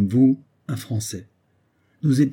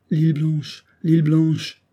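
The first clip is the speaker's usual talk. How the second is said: raised, higher-pitched repeated cry; dying madman's cry; 5 semitones higher, 3 dB louder, slower than usual. A narrator's reading. Madman on his deathbed still naming the island, crying out. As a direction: cry the name out twice, pitched up. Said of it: "en mourant, il s'écriait encore".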